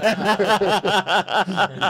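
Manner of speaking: a loud, high burst of laughter; real outburst of laughter. Real, not acted. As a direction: crack up laughing. Bursts out laughing mid-story.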